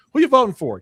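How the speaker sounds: high, loud questioning lilt; mimicked interviewer. Interviewer asking a woman about her vote, mimicked questioning. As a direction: put on an eager interviewer's question.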